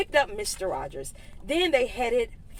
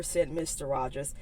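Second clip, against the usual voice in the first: plain reading voice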